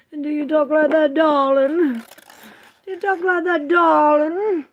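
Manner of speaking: very high, loud affected questioning; put-on "darling" voice. Mock-asking a viewer about their talk, high loud affected voice. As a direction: call it out high, leaning on darling. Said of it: "Who talks like that?".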